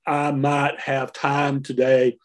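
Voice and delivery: slow Southern drawl on the long i's; Southern accent. Shown as a demonstration. Linguist showing how Southern English sounds, Southern accent. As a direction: draw out "might" and "time" as Southern "maht" and "tahm". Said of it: "if I started speaking Southern English".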